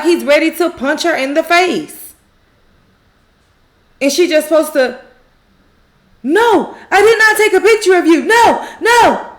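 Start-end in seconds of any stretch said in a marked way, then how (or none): 6.2-9.3 high, loud, rapid protest; emphatic acted denial. Karen denying she took a picture, loud indignant protest. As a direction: deny it loudly at a raised pitch.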